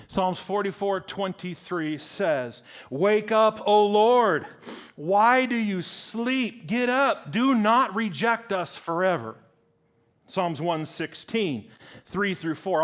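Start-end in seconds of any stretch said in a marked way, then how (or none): 2.9-9.4 raised, forceful scripture cry; urgent plea. Acted. Preacher reads the psalm to wake the Lord, urgent plea. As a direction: call out to wake a sleeping God.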